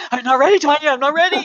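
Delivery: a playful mock of nervous pleading; mock panic. Retelling a nervous guest begging out of her talk, mock panic.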